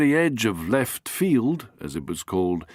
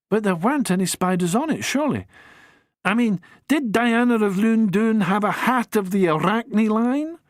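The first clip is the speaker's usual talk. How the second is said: lightly voiced young woman, quick and higher; young woman's voice; 7 semitones higher than usual. Amy presses on with questions, quick and higher. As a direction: press on eagerly, a bit faster.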